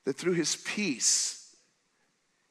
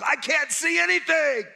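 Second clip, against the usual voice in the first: mock distress; 10 semitones higher, 6 dB louder than usual. Life shakes us up.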